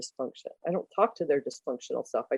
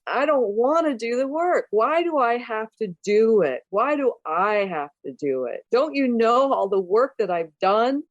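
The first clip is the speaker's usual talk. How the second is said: imitated client whining in higher pitch; mock indignant complaint, pitched up; whiny protest; 6 semitones higher than usual. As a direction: protest like a put-upon child.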